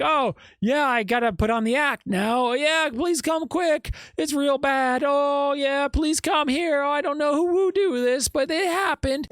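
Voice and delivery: high, hollow put-on distress; mock someone faking the panic; fake distressed act. Alec faking the 911 call, hammed-up fake distress. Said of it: "it was just like".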